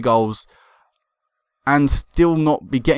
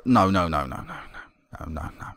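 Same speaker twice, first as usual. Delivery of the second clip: whispered, fading no no no; despairing whisper; 9 semitones lower, 8 dB quieter than usual. Real, not acted. Manager whispering in despair at conceded goal. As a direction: sink into a hushed groan of disbelief.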